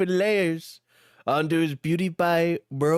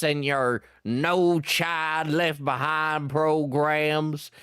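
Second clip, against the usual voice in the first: put-on lower, slow, ranting hillbilly accent; ranting country voice; 4 semitones lower, slower than usual. Shallot rants at Diesel in his country accent. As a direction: rant it in the country voice, building. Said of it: "he exclaimed".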